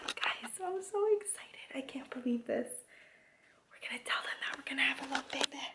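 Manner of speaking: high, breathless excited whisper; fast giddy hushed gush. Real, not acted. Mom whispering her excitement to the camera.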